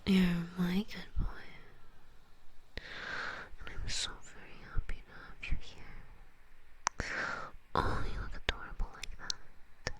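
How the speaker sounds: dote on the listener in a lingering, intimate whisper; doting whispered praise. Pretend.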